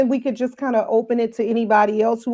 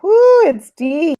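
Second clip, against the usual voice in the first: higher, louder, slow Southern belle drawl; 7 semitones higher, 6 dB louder, slower than usual. Shown as a demonstration. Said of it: "my old Southern Belle voice".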